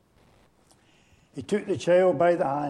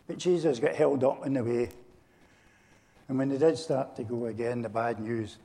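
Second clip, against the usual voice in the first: ordinary delivery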